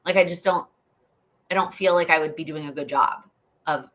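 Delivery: ordinary talk, nothing marked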